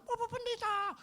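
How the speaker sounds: high call of the pastor's name; calling from outside; retold shout. The shopkeeper calling the pastor from outside, a high mock shout. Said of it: "dia udah berteriak".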